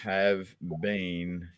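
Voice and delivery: word-by-word dictation with long pauses; writing it down. Acted. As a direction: dictate it to yourself as you write. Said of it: "I'm writing that down".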